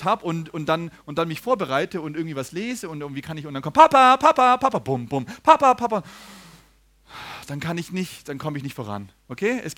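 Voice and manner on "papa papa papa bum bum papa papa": put-on loud, high children's calls of Papa; kids calling Papa. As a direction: burst in shouting Papa like toddlers. Kids bursting in calling dad.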